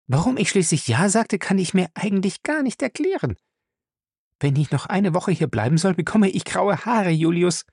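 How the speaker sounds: put-on high, puzzled and anxious young woman; uneasy young wife. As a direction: wonder aloud, half worried. Fay confides to Julius.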